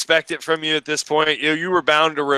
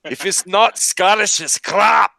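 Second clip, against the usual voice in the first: slow mock Scottish slogan; Scottish accent; 4 semitones higher, slower than usual. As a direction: sell it like a Scottish shop ad. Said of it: "I do enjoy the Scottish accent".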